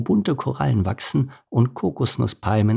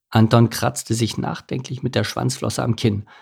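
ordinary delivery

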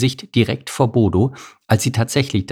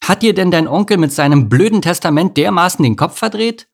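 higher, earnest reproach; serious reproach; 7 semitones higher, 4 dB louder than usual. A narrator's reading. Ant reproaching Bodo, serious. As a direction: hold him to account. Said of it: "sagte Amelie ernst".

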